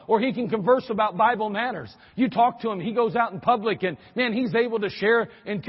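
ordinary talk

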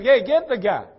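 loud, urgent summons; calling out. Acted. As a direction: call out an order to those nearby. Preacher voices Jesus calling out.

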